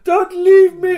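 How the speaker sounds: high, loud cry for help; desperate plea. Retold or imitated. Squire left behind begging the player, desperate high cry. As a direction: plead at full voice, re-enacted and hammed up.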